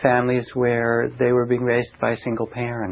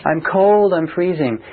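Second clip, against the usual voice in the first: higher, louder cold complaint; crying out; 5 semitones higher, 4 dB louder than usual. Retold or imitated. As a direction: call out that you're freezing. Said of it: "someone will cry out".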